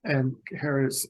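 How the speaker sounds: ordinary talk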